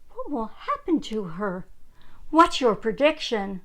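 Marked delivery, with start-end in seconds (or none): none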